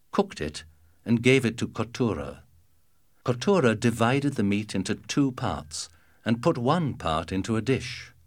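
plain reading voice

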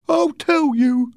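high, drawn-out angry retort; angry sailor. Acted. Angry man answering Silver, high and heated. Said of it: "his face red and angry".

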